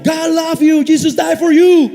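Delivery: inglés de predicador americano, más agudo; imitando a Billy Graham. Retold or imitated. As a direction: imita ligeramente al evangelista en inglés. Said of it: "Y ahí está él predicando". (imitación ligera de Billy Graham predicando en inglés)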